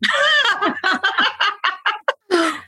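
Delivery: high burst of real laughter; long, high-pitched laugh in quick bursts. Real, not acted. Clinic worker cracking up at her own story, high laughter.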